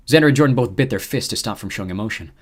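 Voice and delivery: ordinary delivery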